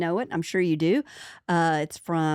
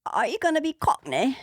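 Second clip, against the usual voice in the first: bad British accent; 7 semitones higher than usual. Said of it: "really bad British accents".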